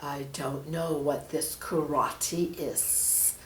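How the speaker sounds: low, slow, puzzled serpent; a lightly voiced sly snake, drawn out. Snake puzzling over karate, slow low voice.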